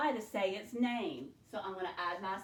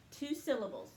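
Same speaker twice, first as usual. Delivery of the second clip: usual speaking voice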